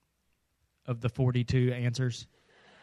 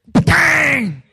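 bang, shouted much higher and louder; loud bang sound effect; 13 semitones higher, 11 dB louder than usual. Acted. Preacher acting his finger jabbing his eye, loud bang. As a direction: slam it out like an impact.

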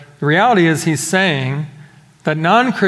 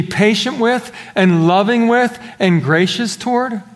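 raised, urgent pulpit voice; fervent preaching; 5 semitones higher than usual. Acted. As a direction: press the congregation hard. Preaches at full force.

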